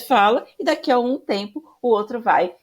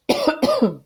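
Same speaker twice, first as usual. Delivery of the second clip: high strained cough as voice fails; sharp dry cough; 13 semitones higher than usual. Real, not acted. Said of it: "Desculpa de novo, tá falhando a voz aqui".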